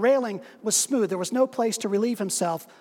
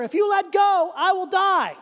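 high, loud urgent shout; 8 semitones higher, 4 dB louder than usual. Acted. Dangling stranger shouts up to the man on the bridge. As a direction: shout the warning up.

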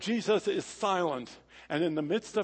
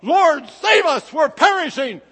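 roaring shout; 7 semitones higher, 11 dB louder than usual. Acted. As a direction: shout it out in terror. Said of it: "they shout with a roar".

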